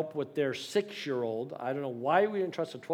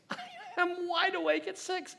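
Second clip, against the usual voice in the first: high-pitched, laughing mock anguish; comic dismay. Teller reliving waking at six next morning, comic dismay. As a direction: squeeze it out high, half laughing.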